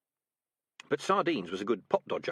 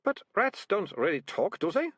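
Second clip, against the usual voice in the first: put-on bewildered girl; 9 semitones higher than usual. A narrator's reading. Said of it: "now quite bewildered".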